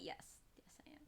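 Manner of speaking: softly whispered admission; teasing whisper to the cat. Real, not acted. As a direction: breathe it out teasing.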